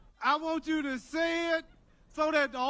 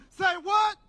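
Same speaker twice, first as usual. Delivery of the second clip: high, loud call and answer; rallying shout; 5 semitones higher, 5 dB louder, slower than usual. Shown as a demonstration. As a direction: shout the cue, then the answer. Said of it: "say it so loud".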